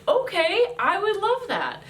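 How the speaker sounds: light up with joy at his offer; retold happy yes, pitched well up; delighted. Chaplain delighted he'll pray.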